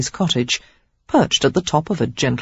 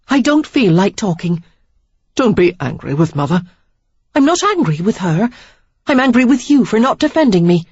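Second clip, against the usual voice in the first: put-on young woman's voice, pitched up and heated; higher, louder reproach building to a burst; Sarah, indignant; 5 semitones higher, 4 dB louder than usual. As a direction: let her hurt boil over at him.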